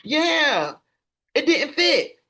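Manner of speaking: mocking entitled parent. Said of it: "You can't say".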